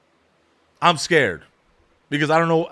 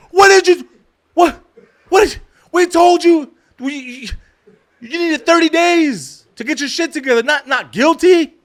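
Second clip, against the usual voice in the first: exasperated, high-pitched yelling; sputtering, shouted telling-off of a friend; Eric; 13 semitones higher, 7 dB louder than usual. Retold or imitated. Eric scolding him, retold.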